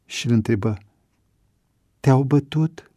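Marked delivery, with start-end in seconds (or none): 2.0-2.9 worried. A narrator's reading. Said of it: "Avi, îngrijorat".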